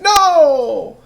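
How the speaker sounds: high, loud, wailing and anguished; a king wringing his hands in hammed-up despair; distraught king. King regrets his rule and wails high and loud. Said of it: "so distraught and upset and sad".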